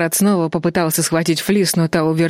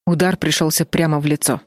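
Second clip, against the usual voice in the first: ordinary delivery, nothing marked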